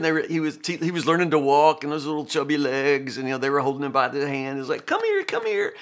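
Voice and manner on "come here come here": high, sing-song coaxing call; parents coaxing toddler. Retold or imitated. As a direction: coax a toddler to come to you. Parents calling toddler to walk.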